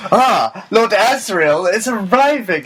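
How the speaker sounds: high, loud theatrical proclamation; stepping-forward stage announcement. Retold or imitated. Stealing a line onstage to announce Lord Asriel, theatrical proclamation. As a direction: announce the lord's arrival with actorly flourish. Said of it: "she stepped forward as well and, like, did a".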